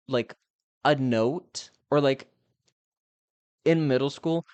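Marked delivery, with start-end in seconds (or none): none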